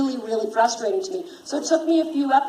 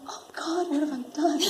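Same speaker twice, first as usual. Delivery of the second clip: whispered, higher mock horror; retold dread; 7 semitones higher than usual. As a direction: act out dawning regret in a high half-whisper. Mock dread at past decision.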